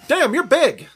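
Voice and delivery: high, exclaiming imitation of an impressed stranger; mock amazement. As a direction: blurt it out high, imitating someone taken aback. Retold first reaction of visiting coworkers.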